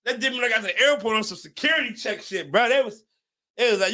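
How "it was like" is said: normal talking voice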